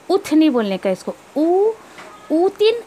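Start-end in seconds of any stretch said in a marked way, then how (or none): 1.4-2.8 ऊ and ऊतिन said high, with a falling end; wrong reading. Shown as a demonstration. Teacher imitates the wrong vowel for students. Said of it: "ऐसा नहीं पढ़ने का".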